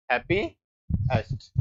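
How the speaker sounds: drawn out word by word while writing; slow dictation. Shown as a demonstration. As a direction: say it slowly as you write it on the board. Teacher writing on board. (धीमे, लिखवाते हुए)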